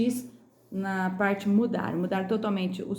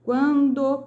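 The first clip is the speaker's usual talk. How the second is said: QUANDO said loud and high; pronúncia em destaque; 5 semitones higher, 7 dB louder than usual. Shown as a demonstration. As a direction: punch out "Quando", drawing out both syllables. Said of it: "Pronuncie as palavras em destaque". ("Quando" em destaque, forte e agudo)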